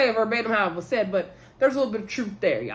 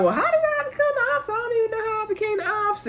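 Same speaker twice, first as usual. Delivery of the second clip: much higher mock confused voice; Tisha's voice; 12 semitones higher, faster than usual. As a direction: squeak it out in a high puzzled imitation. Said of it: "I'm just doing everybody's voice in the video".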